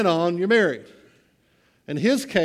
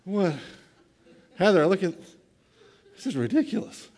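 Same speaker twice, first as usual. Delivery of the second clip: put-on half-laughing at the silly kid; laughing exasperation. Father laughing to his wife Heather, exasperated. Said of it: "I'm just going".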